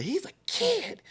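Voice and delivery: high-pitched imitation of a protective mom; acted-out indignant protest; aggressively compelling. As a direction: argue it high and insistent, as retold. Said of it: "just aggressively compelling argument".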